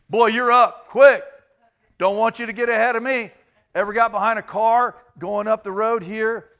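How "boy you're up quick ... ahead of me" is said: louder, higher, hurried shove ahead; pushy impatient shout. Retold or imitated. Impatient flyer yelling at others to move quick. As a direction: bark at the crowd to hurry.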